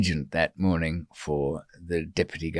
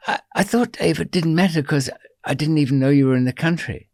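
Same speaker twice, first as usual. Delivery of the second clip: imitated higher-pitched protest; high-voiced excuse; 7 semitones higher than usual. Billy excusing himself to Dave, imitated high voice. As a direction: plead your excuse in a raised voice.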